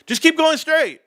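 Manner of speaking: put-on kid voice. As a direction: repeat it brightly to the puzzled cops.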